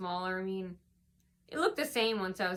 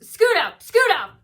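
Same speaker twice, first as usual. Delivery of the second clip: much higher, louder curt command; rude order; 9 semitones higher, 12 dB louder than usual. Retold or imitated. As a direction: bark it out rudely, high and loud. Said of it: "in a really rude tone".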